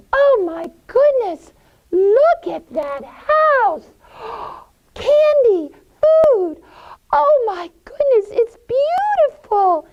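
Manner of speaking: put-on very high, loud gasping kid voices; children amazed by the candy house; so excited. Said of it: "they're so excited".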